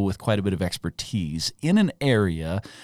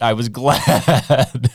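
drawn-out words shaken by laughter; laughing; 2 semitones higher, 5 dB louder, much slower than usual. Real, not acted. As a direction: break into a real laugh while talking. Joking about his lucky recommendation.

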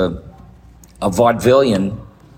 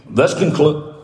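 put-on old radio announcer. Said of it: "big, dumb announcers".

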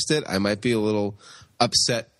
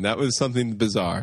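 ordinary talk

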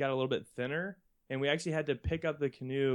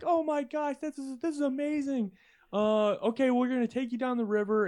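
excited kayaker; 12 semitones higher than usual. Retold or imitated. Kayaker voiced in story.

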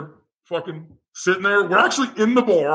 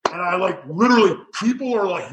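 ordinary talk, nothing marked

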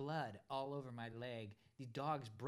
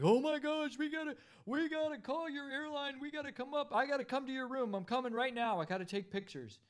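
much higher, louder, frantic manager; freaking out; 10 semitones higher, 6 dB louder than usual. Retold or imitated. Narrator imitates the hotel manager panicking about the dog bites, loud and high. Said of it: "the guy's freaking out".

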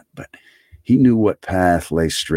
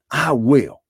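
heartfelt cry; 8 semitones higher than usual. A narrator's reading. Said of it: "cried the old man".

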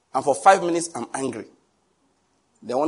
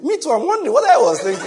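mock wife; 12 semitones higher, 9 dB louder than usual. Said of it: "she'll say the same thing".

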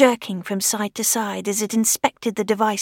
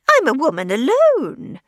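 put-on old lady voice; 5 semitones higher, 5 dB louder than usual. Widow explaining to Fay, high fretful old-lady voice. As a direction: sound like a fretting old widow.

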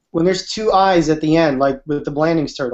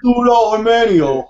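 loud, higher mock Jersey Italian: "Testudo Hermanio"; 4 semitones higher, 4 dB louder than usual. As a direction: ham up a Jersey Italian wiseguy. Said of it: "how they say it in Jersey".

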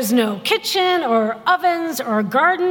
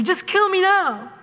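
higher-pitched mock despair; exasperated kvetching; 5 semitones higher than usual. Moses as exasperated grandmother, kvetching to God. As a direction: lightly imitate a kvetching grandmother.

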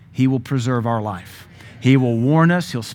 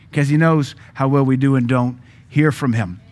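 usual speaking voice

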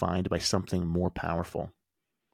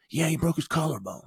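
retold yell; 9 semitones higher than usual. Upset dad snapping at the coach, mock yell. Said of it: "yells at me".

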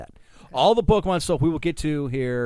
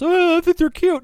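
high mock-cutesy voice, louder; mocking imitation; 6 dB louder than usual. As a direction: mimic the co-host in a high, loud voice. Mocking co-host's remark about cute.

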